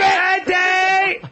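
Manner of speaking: high, drawn-out fight-corner shout; corner yell. Retold or imitated. Training partner imitating fight corners cheering kicks, high shout. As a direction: shout it long like a cornerman at a kick. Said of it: "their corner will go".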